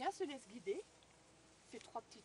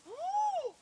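gasp of awe; 9 dB louder than usual. A narrator's reading. Third door opens.

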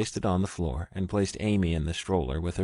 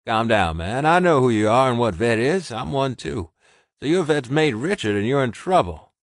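louder gruff wolfman, steady; a big tough guy taking charge; gruff Ross; 5 semitones higher, 7 dB louder than usual. A narrator's reading. Ross on the phone calming Richard, gruff.

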